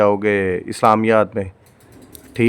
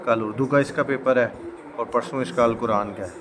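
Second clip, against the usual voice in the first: hushed voice; 9 dB quieter than usual. Real, not acted. Son resting after injection.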